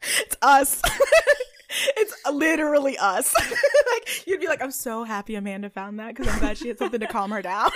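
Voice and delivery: laughing hard through words. Real, not acted. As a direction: talk while cracking up. Laughing at recognition.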